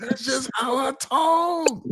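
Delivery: high, drawn-out mock yell; Samuel Jackson. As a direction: stretch it into a strained comic shout. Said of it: "Why are you yelling at me".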